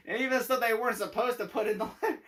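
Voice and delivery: words broken by real laughter; can't stop giggling through it; telling the funny bit through laughter; laughing while talking. Real, not acted. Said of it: "made me laugh".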